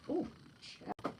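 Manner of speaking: normal talking voice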